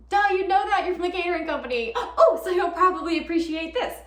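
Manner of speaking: loud, high rush of realization; flustered excitement. Acted. Guest chatting to someone from the catering company, flustered and excited.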